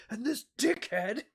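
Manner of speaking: a high, loud jeering put-on voice; mock on-set insult. Mocking the actor in the costume, loud high jeer. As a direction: sneer it out in a silly voice.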